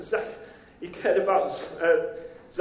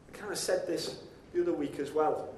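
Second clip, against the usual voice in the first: usual speaking voice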